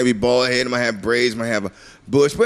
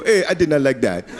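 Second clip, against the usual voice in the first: put-on African accent. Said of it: "and go".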